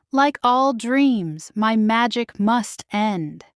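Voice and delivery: slow, measured fairy godmother; slow and gentle caution; slow wise warning. Acted. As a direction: let the warning land slowly.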